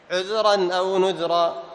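slow, measured recitation of the verse; slow tajweed reading, held vowels; Quran recitation. Shown as a demonstration. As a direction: recite the verse deliberately, as in class. Recites verses to test pauses.

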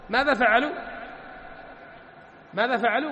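ordinary talk, nothing marked

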